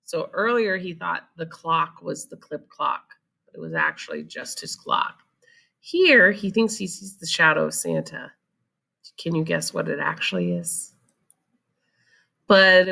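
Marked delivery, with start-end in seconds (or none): none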